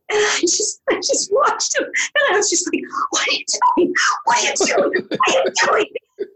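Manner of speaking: shrill rising panic, near a shriek; panicked high cry. Retold or imitated. Actress watching her castmate exit the wrong way, panicked cry. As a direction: squeal it out, high and desperate. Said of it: "Angriest line reading you've ever heard".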